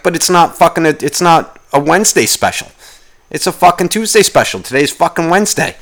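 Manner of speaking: high, worked-up protest; angry retort. Retold or imitated. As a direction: argue it indignantly, pitched well up. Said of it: "And I got angry about that".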